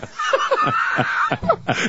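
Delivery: squealing high cackle; high shrieking laugh. Real, not acted. Telling his eclipse story, shrieking with laughter. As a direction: burst into a shrill laugh.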